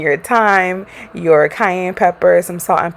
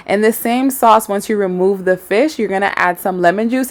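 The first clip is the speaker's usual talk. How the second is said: ordinary talk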